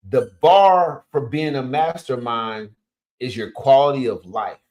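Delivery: slow, loud, word-by-word delivery; slowed for note-takers. Real, not acted. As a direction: spell it out slowly so they can write it down. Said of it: "so I gotta slow down".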